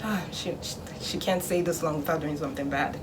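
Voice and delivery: low, softened musing to oneself; suspicious parent. Acted. Parent sensing the good child is up to something, suspicious. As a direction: imitate a parent quietly smelling trouble. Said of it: "their parents' sense is tingling".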